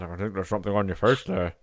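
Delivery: low, slurred Scottish impression; Sean Connery. Bond remarks on someone's face, Sean Connery impression. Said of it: "Sean Connery would be saying something like that".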